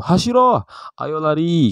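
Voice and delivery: high-pitched urging call. Shown as a demonstration. Teacher acting out an order to run, calling out. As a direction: call out a command, pitch leaping up.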